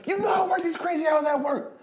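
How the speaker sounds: high, loud desperate outburst; blurting shout. Retold or imitated. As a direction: let it all spill out in a shouted rant. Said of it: "he blurted out and said".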